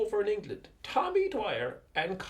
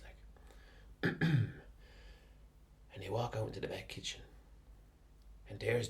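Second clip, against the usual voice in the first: hushed sneaking; 11 semitones lower, 8 dB quieter than usual. Acted. Sneaking out at night.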